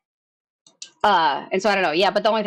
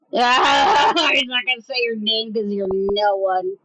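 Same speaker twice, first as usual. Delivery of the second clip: slow laughing shout; laughing taunt; slower than usual. Real, not acted. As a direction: laugh it in his face.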